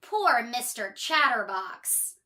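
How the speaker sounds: ordinary delivery, nothing marked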